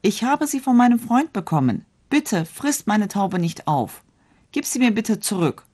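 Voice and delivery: desperate high plea to the wolf; pleading. A narrator's reading. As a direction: beg the wolf to spare the dove. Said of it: "Da flehte der junge Mann".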